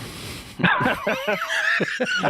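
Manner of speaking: sudden high cackling laugh; high burst of laughter. Real, not acted. Host cracking up at his cookie joke, high laugh. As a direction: laugh high and hard at your own joke.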